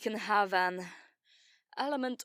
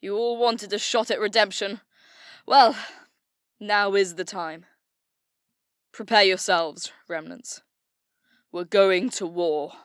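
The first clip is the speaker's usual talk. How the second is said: loud, building war speech with a big shout on Well; rallying cry; 8 dB louder than usual. Acted. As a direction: rouse the troops to fight. Leader rallies troops before war.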